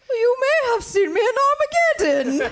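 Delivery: high, loud British accent, badly missing an American actress; British-accented Liv Tyler impression. Contestant attempts Liv Tyler impression for the host, mock British accent. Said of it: "got a British thing going on".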